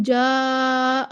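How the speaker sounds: "जा" held long with a heavy, dark vowel; broad, back alif in "जा"; गलत मोटा अलिफ. Shown as a demonstration. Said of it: "नहीं पढ़ेंगे". ("जा" का अलिफ मोटा, खींचकर)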